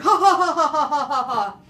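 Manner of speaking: loud booming ha ha ha; a big belly roar of laughter. A narrator's reading. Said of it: "roared the biggest roar of laugh".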